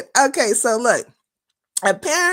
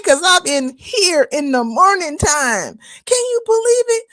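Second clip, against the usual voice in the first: ordinary talk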